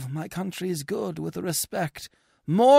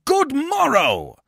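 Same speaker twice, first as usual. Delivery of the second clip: scornful good morrow; 12 semitones higher, 6 dB louder, slower than usual. Acted. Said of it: "to have it with saying".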